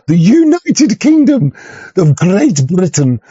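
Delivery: hammed-up stately announcement; mock grandeur. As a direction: puff it up like a royal title. Mocking grand official name.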